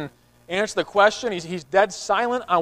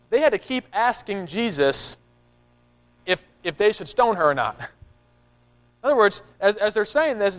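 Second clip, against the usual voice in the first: ordinary talk, nothing marked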